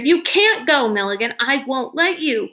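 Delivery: high, fierce, pleading outburst; fierce plea. A narrator's reading. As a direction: refuse to let him go, fiercely. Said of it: "with a ferocity that surprised even her".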